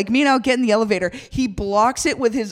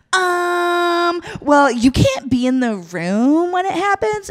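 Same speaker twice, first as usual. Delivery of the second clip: higher, drawn-out condescending um; mock a snippy front-desk clerk; snooty clerk; 8 semitones higher, slower than usual. Clerk refusing a guest, snooty mock voice. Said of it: "And he's like".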